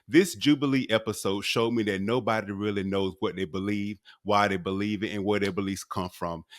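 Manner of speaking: low, even scripted delivery; prepared monologue read. Real, not acted. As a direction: read out a written commentary piece.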